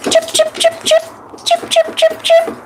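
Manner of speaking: very high chirping; bird chirps. Acted. Tiny birds hopped by.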